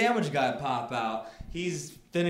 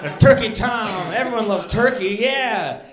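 louder, higher burst of triumph; acted gleeful excitement; 5 semitones higher, 7 dB louder than usual. Rider picks a sandwich from his bag, gleeful. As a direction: exclaim it like a great find.

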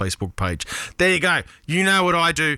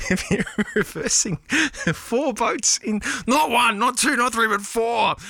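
gleeful escalating rave; excited rant; 12 semitones higher than usual. Real, not acted. Building rant about boat reversing.

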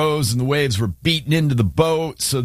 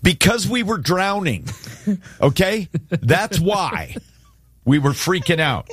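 higher-pitched comic protest; mock-exasperated aside; 6 semitones higher than usual. Disciples answering Jesus's question about their fear, mock-exasperated. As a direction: answer back as an indignant disciple. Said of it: "parenthetically add something here".

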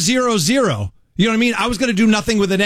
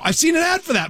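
high excited yelp; excited shout; 11 semitones higher than usual. Retold or imitated. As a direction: blurt it out, pitched way up. Excited reaction to the inventions.

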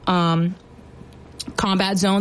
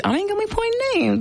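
high teasing squeak; 14 semitones higher, faster than usual. Real, not acted.

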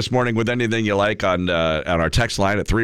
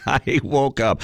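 laughing; 5 semitones higher, slower than usual. Real, not acted. Laughs while starting his Monday story.

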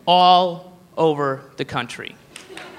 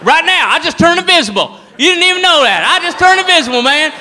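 hammed-up, higher-voiced bar-room boasting; 4 semitones higher, 4 dB louder than usual. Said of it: "they're fucking drunk and dumb".

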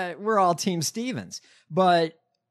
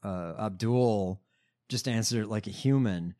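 normal talking voice

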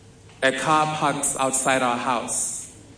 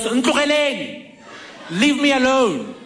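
yelling; 8 semitones higher than usual. Retold or imitated. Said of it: "and yells".

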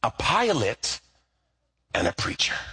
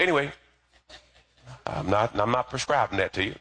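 ordinary talk

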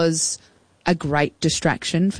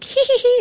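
high-pitched imitated giggle; mock a mischievous tee-hee, much higher than usual; prankster's giggle. Recalling teenage prank calls, mock high giggle. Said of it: "We've all called someone up and pretended".

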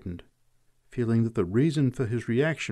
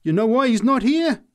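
high, loud triumphant retort; 13 semitones higher, 8 dB louder than usual. A narrator's reading. Preacher answering the drunk, triumphant. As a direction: crow it back at the heckler. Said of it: "she answered triumphantly".

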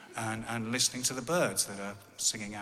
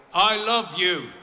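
high, loud, projected "for the hard of hearing"; retold loud declaration; 7 semitones higher, 10 dB louder, slower than usual. Son leaning in to hard-of-hearing dad, loud declaration. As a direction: call it out so he can hear.

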